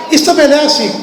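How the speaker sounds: usual speaking voice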